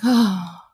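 sighed-out "oh"; long exhaled "oh" of annoyance; demonstrated sigh. Shown as a demonstration. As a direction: let out a displeased, complaining sigh. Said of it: "I would just sigh".

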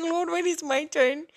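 high, loud whining plea; whiny plea. Retold or imitated. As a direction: whine it like an impatient child. Whining to God, retold.

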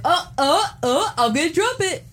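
high flustered fumbling; hammed-up panic about dropping it; mock panic. Mocking cohost fumbling vinyl.

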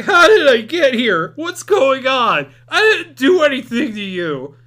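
high, loud and slow, a hammed-up panic; mock frightened whimper. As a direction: play the terrified captive, begging and drawn out. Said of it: "starts whimpering".

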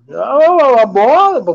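choking on a drink; strained choking voice. Real, not acted. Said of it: "Eu me engasguei".

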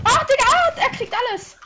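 high loud frantic yell; frustrated shout. Real, not acted. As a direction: cry out as the loot is stolen. Losing loot in fight.

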